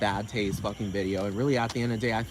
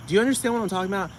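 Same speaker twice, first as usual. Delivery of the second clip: ordinary talk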